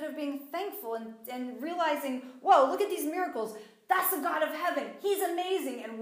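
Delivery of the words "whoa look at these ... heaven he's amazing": high, loud, excited awe; amazed exclamation. Acted. Israelites seeing God's miracles, excited and amazed. Acting out awestruck, thankful Israelites.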